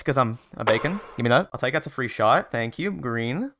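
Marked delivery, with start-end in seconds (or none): 2.9-3.5 breathy, hushed little boast; odd catchphrase voice. Real, not acted. Gamer whispering his shot call to himself, a soft odd catchphrase voice. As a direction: murmur it like a smug signature line. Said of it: "I don't know why I talk like that".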